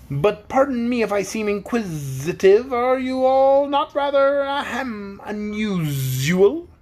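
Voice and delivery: high, slow, prying bug; Wogglebug voice. A narrator's reading. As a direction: linger on the word, curious.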